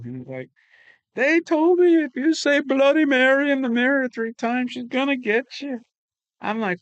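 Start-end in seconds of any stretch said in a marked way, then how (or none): 1.1-5.8 put-on high, louder, frightened child telling a rumor; imitating a scared little kid. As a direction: play the spooked young son, voice pitched well up. Freaked-out son voiced in story.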